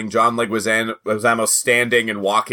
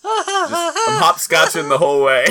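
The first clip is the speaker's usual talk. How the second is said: high-pitched giggling through the words; slow, laugh it out, voice high; slower than usual. Real, not acted. Laughing about racing.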